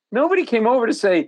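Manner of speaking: rising disbelief at nobody helping; indignant. Real, not acted. Outraged telling of neglect.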